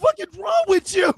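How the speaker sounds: high indignant shout; high angry disbelief; outraged yell. Real, not acted. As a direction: fling it at him in disbelief. Ranting at a movie father.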